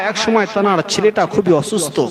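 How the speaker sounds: ordinary delivery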